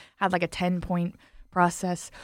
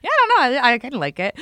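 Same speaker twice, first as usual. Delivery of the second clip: sheepish reply; 5 semitones higher, 5 dB louder, much faster than usual. Retold or imitated. Her sheepish reply, quoted.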